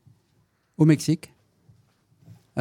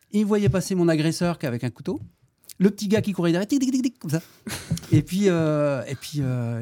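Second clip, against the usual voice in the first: usual speaking voice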